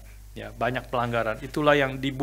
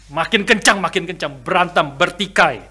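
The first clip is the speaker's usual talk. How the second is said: getting louder; 4 semitones higher, 7 dB louder than usual. Shown as a demonstration. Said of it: "berteriak satu dengan yang lain".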